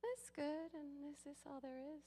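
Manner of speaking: high, mock-bored voice; hammed-up bored voice; bored contentment. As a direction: act out someone lazily content. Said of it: "it's like".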